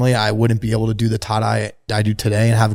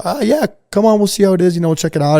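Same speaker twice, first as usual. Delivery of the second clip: pitched way up, bright eager agreement; eager yes; 8 semitones higher, faster than usual. Retold or imitated. Friend happily inviting him into the tie-dye studio, eager. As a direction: toss off a delighted sure, come on.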